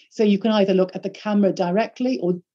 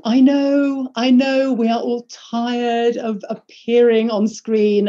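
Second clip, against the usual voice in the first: mock commiseration; 3 semitones higher, 3 dB louder, slower than usual. Voicing sympathy with webcam-weary audience.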